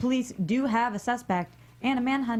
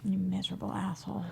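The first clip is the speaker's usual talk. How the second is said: much softer, bitter mutter; muttered self-reproach; 2 semitones lower, 6 dB quieter, faster than usual. Acted. Ramona's conscience scolds her, muttered. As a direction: curse yourself under your breath.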